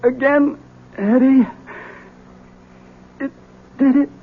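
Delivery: high, strained words of a dying man; gasping out disbelief after being shot; wounded, in shock. Acted.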